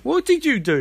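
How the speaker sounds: high-pitched retold dismay; mock alarm. Sister confronting brother over his deed, mock aghast.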